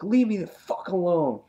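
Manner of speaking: high-pitched acted dismissal; going off. Alan ending the argument with Andrea, going off. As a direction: drive her away angrily, voice up high. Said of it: "he was going off".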